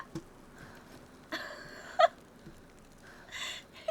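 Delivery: long high airy laughter; giddy winded laugh; breathless laugh. Real, not acted. Laughing after the hit.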